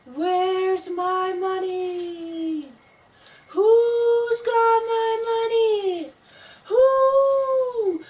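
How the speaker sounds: very high, slow, wailing ghost voice; ghost crying. Acted. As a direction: wail from far off like a lost spirit. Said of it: "a voice crying".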